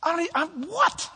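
high, baffled shock at the accusation; high, stunned and baffled; shocked disbelief. Retold or imitated. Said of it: "I go".

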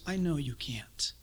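soft whispered reassurance; gentle whispered voice of God; Lord's whisper. Acted. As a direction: whisper it close and tender. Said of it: "the Lord says".

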